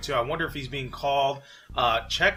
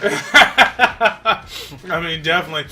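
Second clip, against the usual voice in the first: laughing, higher and louder; 4 semitones higher, 10 dB louder, much slower than usual. Real, not acted. Still laughing at the co-host's joke about the accent. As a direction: laugh the words out.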